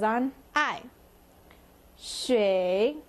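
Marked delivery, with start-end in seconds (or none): 2.0-3.0 "shui" dipping low, then rising; third tone. Shown as a demonstration. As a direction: draw "shui" down and back up. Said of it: "so down and up".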